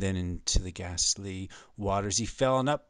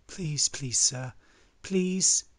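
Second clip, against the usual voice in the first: high, insistent begging; hammed-up pitched-up pleas; pleading beggar; 7 semitones higher, slower than usual. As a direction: plead like a beggar asking for help. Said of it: "Stop begging".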